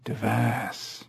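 tentative, hushed guttural copy; repeated ape sound. A narrator's reading. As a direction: echo the animal's sound quietly. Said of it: "Hager repeated the sound back".

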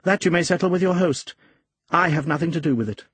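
lightly voiced woman washing her hands of him; high-pitched, brisk female brush-off; voiced woman character. A narrator's reading. Narrator voices the woman answering him.

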